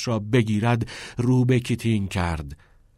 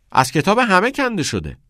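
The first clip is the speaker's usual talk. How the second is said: high, louder schoolboy voice; schoolboy reporting; 10 semitones higher, 5 dB louder than usual. A narrator's reading. Pupil Cameron reports to teacher Nolan, high-pitched protest.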